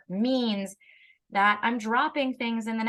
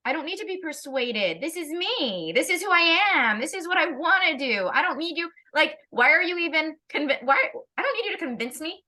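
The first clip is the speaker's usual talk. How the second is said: put-on higher, indignant child pushing back on being convinced; defiant kid; 6 semitones higher than usual. A child telling a parent off for trying to convince them, higher and indignant. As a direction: play a kid offended at being persuaded, pitched up. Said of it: "So it's like".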